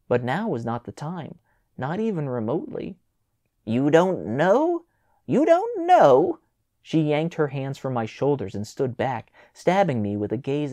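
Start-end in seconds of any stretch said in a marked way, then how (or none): 3.6-4.8 incredulous. A narrator's reading. Mother repeats daughter's answer, incredulous. 5.3-6.4 much higher, louder shriek of outrage; furious shriek. A narrator's reading. Mother hearing her daughter's shrug, shrieking in outrage.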